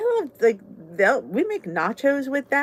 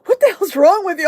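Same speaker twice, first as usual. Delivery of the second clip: husband's outburst; 9 semitones higher, 9 dB louder than usual. Retold or imitated. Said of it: "Steve goes".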